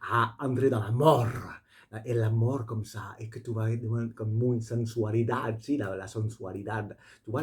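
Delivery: put-on a teasing Spanish accent on sensuality. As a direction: roll the French with a playful Spanish accent. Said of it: "j'ai fait cet accent".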